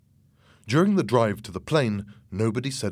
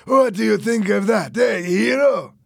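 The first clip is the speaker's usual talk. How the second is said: high, loud jeering; mocking yell; shouted taunt; 11 semitones higher, 7 dB louder than usual. A narrator's reading. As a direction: goad him at full voice. Fernando jeers loudly.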